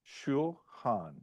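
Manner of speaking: "shulchan" split as shul, tiny break, chan; two separated syllables in "shulchan", shul-chan; break inside shulchan. Shown as a demonstration. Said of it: "a little bit of a break".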